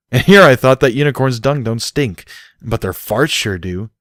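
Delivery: grumbled sarcastic jab; disgusted grunt. A narrator's reading. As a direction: complain with wry disgust. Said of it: "Barry grunted".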